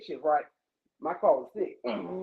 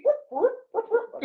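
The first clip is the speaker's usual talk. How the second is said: siren imitation; 10 semitones higher than usual.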